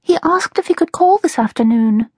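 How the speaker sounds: put-on high-pitched, lightly sighed girl's line; sighing. A narrator's reading. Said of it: "Melody sighed".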